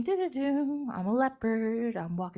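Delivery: lilting sing-song stroll; playful storybook animal voice; carefree leopard. Acted. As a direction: hum along obliviously as a happy leopard. Acting leopard strolling along, carefree.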